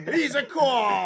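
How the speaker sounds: put-on high, loud Boston accent shout. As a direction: bellow it in a hammy Boston accent. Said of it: "it's like The Departed".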